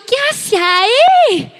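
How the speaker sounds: grito agudo de madre alarmada, alargando el final. Retold or imitated. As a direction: pregunta con susto, subiendo mucho el tono. Mother's alarm at child on slide. (madre asustada que pregunta en tono agudo)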